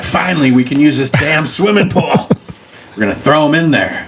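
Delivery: high-pitched mock glee; hammed-up excitement, pitched well up; overeager watchman. Bored watchman plotting against trespassing teens, gleeful excitement.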